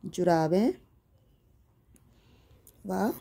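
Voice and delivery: slow single words, long gaps between; writing-along dictation. Real, not acted. Teacher writing out winter clothes for pupils, slow halting dictation. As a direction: dictate the list one word at a time. Writing the next answer aloud.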